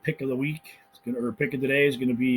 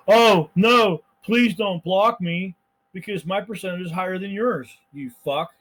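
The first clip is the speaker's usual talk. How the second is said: mock pleading; 6 semitones higher, 5 dB louder, slower than usual. Sarcastic plea to blocker.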